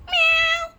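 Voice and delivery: very high loud mock meow; cat meow. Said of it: "making the cat noise".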